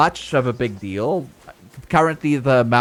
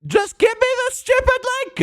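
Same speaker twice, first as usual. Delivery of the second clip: exasperated. Real, not acted. Frustrated with copy button.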